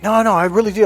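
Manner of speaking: high-pitched imitated protest; protesting client. Client insisting to his coach, protesting.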